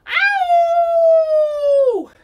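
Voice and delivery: high, loud wolf howl. Shown as a demonstration. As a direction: howl at the full moon. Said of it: "Full moon wolf".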